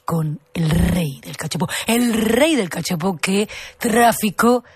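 very slow, modulated investigative presenter; Gloria Serra. Acted. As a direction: drag out each phrase as a suspenseful TV intro. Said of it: "cómo modula la voz".

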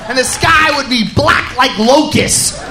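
ordinary talk

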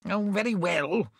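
mildly peevish, grudging give-in; a touch of sulky resignation. A narrator's reading. Constable giving in to the mayor, mildly peevish. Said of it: "said the constable peevishly".

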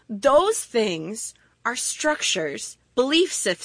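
ordinary talk

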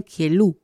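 kielu with the stress pushed onto lu; kielu, stressed last syllable; final stress. Shown as a demonstration. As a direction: hit the end of kielu. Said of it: "pero ahí con el acento también".

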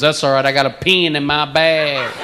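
put-on Texas accent. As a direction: imitate a Texan, stretching BAG. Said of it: "You talk about accent differences".

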